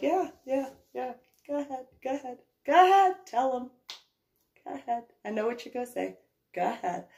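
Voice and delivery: high-pitched, impatient go-ahead; egging him on. Retold or imitated. Homeowner prompting Mike to tell the man, egging on.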